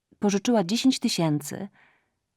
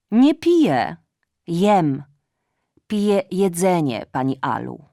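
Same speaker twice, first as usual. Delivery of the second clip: cat voice; 6 dB louder than usual. Acted. Cat answering mother.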